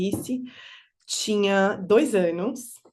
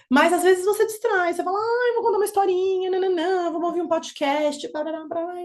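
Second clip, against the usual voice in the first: animada distraindo; 8 semitones higher than usual. Retold or imitated. Mãe imitando distração lúdica.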